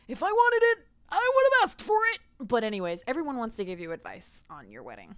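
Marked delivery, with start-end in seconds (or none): 0.1-2.2 higher and louder, fed up; indignant, higher and louder; exasperated outburst. Real, not acted. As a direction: snap it back at the advice-givers. Annoyed at unwanted advice.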